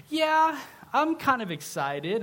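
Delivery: high, drawn-out yeah, acted hesitancy; half-hearted excitement. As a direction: imitate a student only kind of excited. Acting out a half-keen student's reply.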